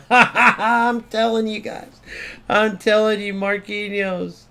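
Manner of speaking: high, loud, drawn-out gloating through laughter; told-you-so, laughing and elated; laughing triumph. Real, not acted. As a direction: crow it with a real laugh. Defender makes the save he predicted.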